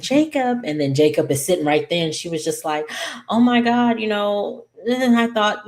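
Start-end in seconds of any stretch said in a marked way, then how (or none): 0.0-0.6 much higher, loud screamed "Jacob"; retold scream of her husband's name, pitched way up; screaming his name. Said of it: "like, screaming". 3.3-5.7 much higher, louder excited gush; wife seeing her husband alive, high loud excited gush; overjoyed relief. Retold or imitated.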